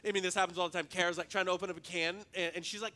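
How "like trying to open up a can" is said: ordinary talk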